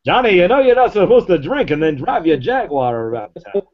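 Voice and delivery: put-on old-timey scolding announcer again, high; vintage radio narrator voice; 1930s newsreel voice. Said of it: "That's like the nineteen thirties voice".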